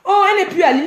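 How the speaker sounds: singer les fans, voix plus haute et moqueuse; imitation moqueuse. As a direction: ragot moqueur lancé plus haut et plus fort. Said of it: "Vous n'avez pas dit que". (elle imite ses fans qui jasent, moqueuse et aiguë)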